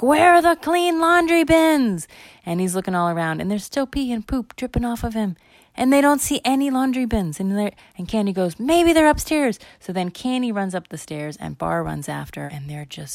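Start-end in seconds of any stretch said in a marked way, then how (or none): 0.0-2.1 put-on high, loud, drawn-out kid wail; acted desperate shout; frantic yell. Bar frantically hunts for towels. 8.6-9.6 put-on high, loud kid voice; acted high-pitched suggestion; frantic little girl. Candy frantically suggests upstairs.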